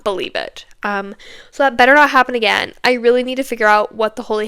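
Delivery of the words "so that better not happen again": ordinary delivery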